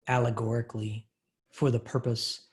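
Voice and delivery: usual speaking voice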